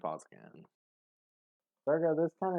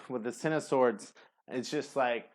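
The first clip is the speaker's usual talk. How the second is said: usual speaking voice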